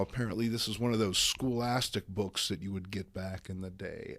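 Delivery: ordinary delivery, nothing marked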